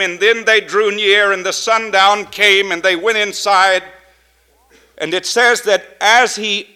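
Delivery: loud, rising preacher's cadence; fervent pulpit preaching. Acted. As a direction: preach it out to the congregation. Preaching at full force.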